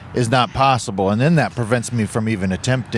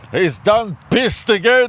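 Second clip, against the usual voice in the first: slow, higher Southern drawl on "done pissed"; hammed-up drawl; 7 semitones higher than usual. Riffing on the friend's line in a hammed-up drawl. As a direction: stretch "He's done pissed again" lazily.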